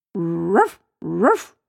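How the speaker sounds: imitated dog's ruff, rising sharply; two separate mimicked woofs; dog bark. A narrator's reading. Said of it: "incontrò un grosso cane". (verso di cane che abbaia)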